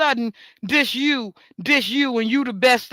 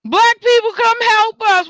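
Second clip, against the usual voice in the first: high, loud pleading call; mock plea for help; 10 semitones higher, 6 dB louder than usual. Others calling for help, mocked.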